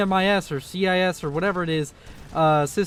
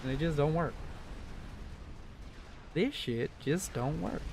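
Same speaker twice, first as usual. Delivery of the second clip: ordinary delivery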